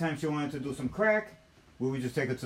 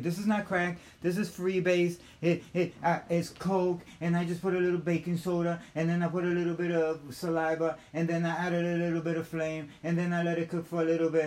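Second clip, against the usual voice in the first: higher-pitched, steady mimicry of her; woman explaining herself; 5 semitones higher than usual. Woman justifying her freebase recipe, insistent higher voice. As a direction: reel off the steps in a raised, even voice.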